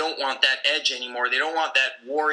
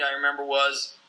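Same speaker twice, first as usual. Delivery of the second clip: usual speaking voice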